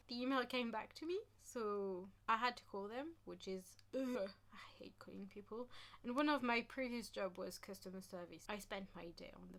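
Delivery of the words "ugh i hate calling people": soft unvoiced grumble; whispered groan. Real, not acted. Vlogger whispering how much she hates phone calls. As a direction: mutter the complaint under your breath. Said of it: "whispered complaint".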